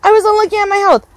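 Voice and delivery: fast, breathless near-death panic; panicked yelp. Real, not acted. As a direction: gamer nearly killed, shrieking in fright. Nearly dies in a raid.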